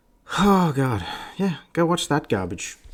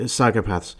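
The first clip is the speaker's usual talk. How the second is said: ordinary delivery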